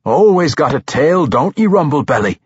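lightly voiced dwarf, laughing at his friend; gruff dwarf teasing. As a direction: rib the halfling like a jolly dwarf. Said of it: "Bruner said with a laugh".

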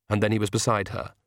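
ordinary delivery, nothing marked

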